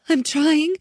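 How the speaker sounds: high, strained frightened reply; trying to hold it together; scared girl. A narrator's reading.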